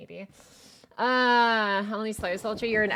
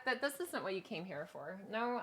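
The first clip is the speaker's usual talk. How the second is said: ordinary talk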